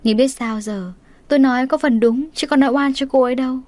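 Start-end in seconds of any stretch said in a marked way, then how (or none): none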